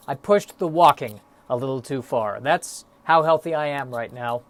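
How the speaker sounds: normal talking voice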